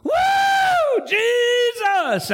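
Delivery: high hollered woo, Jesus; high whooping praise shout; imitated church shout. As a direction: holler it like someone praising God. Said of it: "shouting and hollering".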